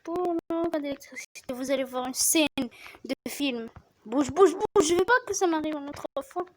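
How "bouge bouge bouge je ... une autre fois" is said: urgent pleading. Real, not acted. Urging shark to flee danger.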